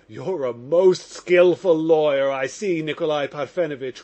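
loud, slowed cheerful exclamation. Acted. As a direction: call it out gaily, a touch of laughter in it. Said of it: "cried Mitya, laughing gaily".

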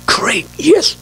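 high-pitched, thrilled little cheer; delighted acceptance. Acted. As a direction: play someone just handed a dream gift. Acts out delighted receiver of car.

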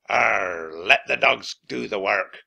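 put-on captain growling at the kids; growled. A narrator's reading. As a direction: growl it dismissively. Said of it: "growled Captain Bones".

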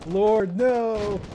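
loud, high cry of dismay; panicked shout. Real, not acted. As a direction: yell in alarm. In-game panic.

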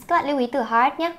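usual speaking voice

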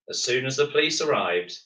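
ordinary delivery

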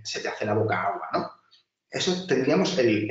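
normal talking voice

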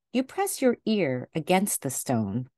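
ordinary delivery

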